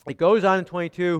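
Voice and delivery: ordinary talk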